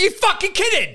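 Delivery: high, squeaky mock disbelief; mock outrage. Mum mocking her son's price with high disbelief. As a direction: squeal it like you can't believe the cheek.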